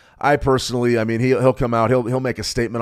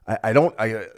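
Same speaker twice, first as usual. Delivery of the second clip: real stumbling restarts on "I"; stuttering. Real, not acted. Trader unsure what to say about the markets, stuttering. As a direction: trip over the first words, restarting haltingly. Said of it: "I know I'm stuttering a lot".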